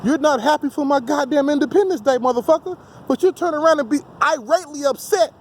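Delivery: usual speaking voice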